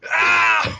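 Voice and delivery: loud, high startled yell; mock cry of alarm; acted scream. Game master as startled crossbowman, loud acted scream.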